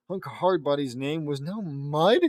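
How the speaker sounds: slow, questioning rise on "Mud"; baffled reading. Real, not acted. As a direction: read it out in disbelief, ending as a question. Reader baffled by the line.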